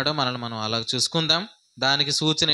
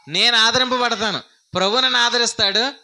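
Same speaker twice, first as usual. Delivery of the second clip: louder, higher declaration of నేను ఆదరింపబడతాను; 7 semitones higher, 5 dB louder than usual. Shown as a demonstration. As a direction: proclaim it aloud with full voice. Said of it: "ఇప్పుడు బయట చెప్పండి". (బిగ్గరగా ప్రకటించండి)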